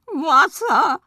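high, loud frog cry; a thirsty frog yelling from the backpack; frog calling out. Acted. Frog shouts for water from the backpack, high and loud. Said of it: "rief Norbert".

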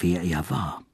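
ordinary delivery, nothing marked

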